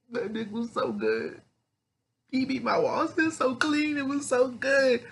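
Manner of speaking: very high, drawn-out mock whimper; high-pitched lament. Salem pining over her dead lover, in a high mock lament. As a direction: wail it high and slow, hamming up the grief.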